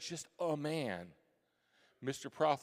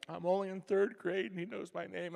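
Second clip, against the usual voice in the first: higher, louder giddy rush; starstruck excitement; 7 semitones higher, 4 dB louder than usual. Retold or imitated. Third grader thrilled the teacher knows him, giddy and higher. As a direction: burst with kid delight. Awestruck kid reacting to teacher.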